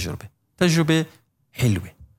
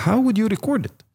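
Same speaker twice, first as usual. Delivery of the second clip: quoted question; 8 semitones higher, much slower than usual. Retold or imitated. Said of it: "you go like".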